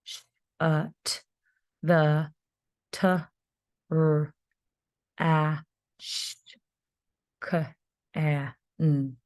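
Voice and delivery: each sound said apart with pauses; teacher sounding out the sentence for first graders; sounding out letter by letter. Shown as a demonstration. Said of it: "another digraph".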